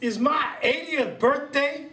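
a mishearing grandpa getting all worked up; birthday man mishearing his wife and laughing; excited old man. Acted. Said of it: "to Jack Hadley".

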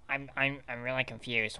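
ordinary talk, nothing marked

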